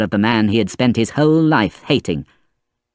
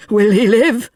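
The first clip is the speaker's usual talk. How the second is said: fearful; 12 semitones higher, 4 dB louder, slower than usual. A narrator's reading. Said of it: "his eyes wild with fear".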